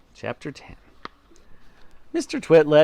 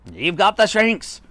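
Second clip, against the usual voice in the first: raised-pitch accusing cry; husband crying out; 7 semitones higher, 4 dB louder than usual. A narrator's reading. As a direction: announce it in mean triumph. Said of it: "cried Mister Twit".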